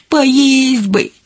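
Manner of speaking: put-on high, drawn-out child's whimper; whining. A narrator's reading. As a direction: whine it like a hungry little girl. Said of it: "захныкала Косичка".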